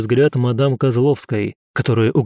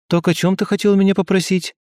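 higher, innocently casual question; casually innocent; 7 semitones higher than usual. A narrator's reading. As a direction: ask as if nothing had happened. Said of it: "Как ни в чём не бывало". (невинно, как ни в чём не бывало)

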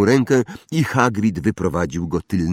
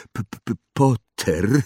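halting, stuttered greeting; nervous stammer; 4 semitones higher than usual. A narrator's reading. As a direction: stutter on the first sound. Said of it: "wyjąkał profesor Quirrell".

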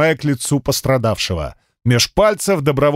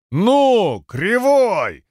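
excited cheer; 7 semitones higher, much slower than usual. Acted. Spectator cheers the cyclops.

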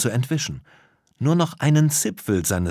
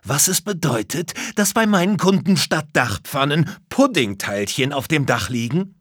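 higher, agitated roofer voice; heated complaint, raised in pitch; worked-up indignation; 6 semitones higher, 3 dB louder than usual. A narrator's reading. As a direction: demand an answer indignantly. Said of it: "regte sich der Dachdecker auf".

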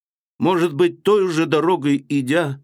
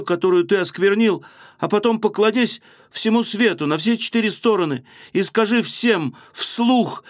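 higher, feverish pleading; trembling fervor; 7 semitones higher than usual. Acted. Sonya pleading with Raskolnikov to confess, in feverish rapture. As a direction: urge him on as if in a fit of passion. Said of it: "вся дрожа, точно в припадке".

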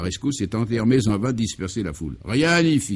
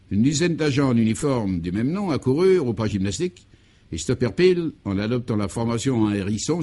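ordinary delivery, nothing marked

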